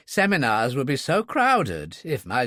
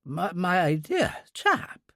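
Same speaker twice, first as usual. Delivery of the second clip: slow, higher, stammered surprise; stunned; 7 semitones higher, much slower than usual. A narrator's reading. Dunkley greets the man who read his book, stunned. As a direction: falter on the words, lightly overcome. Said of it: "Dunkley was stunned".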